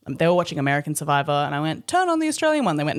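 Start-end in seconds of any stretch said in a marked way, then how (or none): 1.9-2.7 high, bright ask; chirpy request; eager request. Retold or imitated. Asking to change show.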